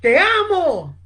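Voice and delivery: high, loud loving call; retold shout. Young Francis shouts love into the yard, loud call. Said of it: "salió y gritó".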